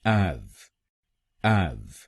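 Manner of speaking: एव said alone as ev; teacher isolates एव; syllable drill. Shown as a demonstration. Teacher drills students on first syllable, ev. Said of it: "हम बोलेंगे".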